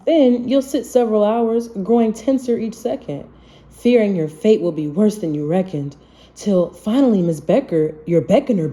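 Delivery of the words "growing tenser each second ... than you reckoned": usual speaking voice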